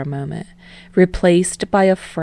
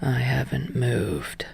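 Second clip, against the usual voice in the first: low, slow, whispered monotone; barely bother to speak; deep slow voice; 4 semitones lower, 7 dB quieter, slower than usual. Acted. Tired man answering Yamada, deep slow whisper. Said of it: "in a deep, slow voice".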